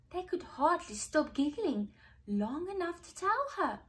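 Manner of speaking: ordinary delivery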